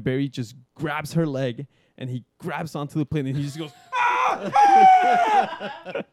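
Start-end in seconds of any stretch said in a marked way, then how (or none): 3.9-6.0 loud high-pitched mock scream; sudden scare scream. Instructor grabs scared girl's leg, high mock scream. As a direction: shriek suddenly to startle. Imitates instructor's startling yell on plane.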